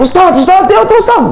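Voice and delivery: high, strained repeated calls of Ustaz; retold frantic screaming. Dying man screaming at the ustaz, high frantic yelling. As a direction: cry out the name over and over in terror. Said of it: "Dia teriak-teriak".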